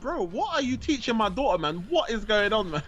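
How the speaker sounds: half-laughing disbelief at his son; mock exasperated dad. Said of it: "I'm like".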